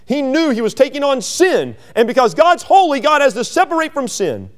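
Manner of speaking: high, loud pleading sermon voice; preacher voicing the anguish of the cross; impassioned preaching. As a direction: cry the psalm out from the pulpit. Preaching surge.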